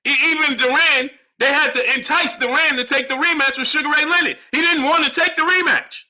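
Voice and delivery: high, heated argumentative voice; exasperated insistence. Real, not acted. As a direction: hammer the point at a stubborn viewer.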